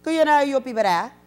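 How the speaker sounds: high, loud Gullah question; imitating a Gullah islander's question; Gullah greeting. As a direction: call it out in Gullah. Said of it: "he says".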